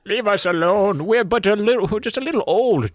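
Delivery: put-on a high, cracked little old kid; mock elderly kid pleading; old child voice. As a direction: play a child who sounds a hundred years old. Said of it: "one of those children a hundred years old".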